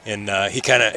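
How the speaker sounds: usual speaking voice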